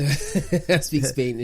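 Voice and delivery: laughs through the words, slowly; chuckling as he answers; laughing. Real, not acted. Teammate asked about Spanish, laughing answer.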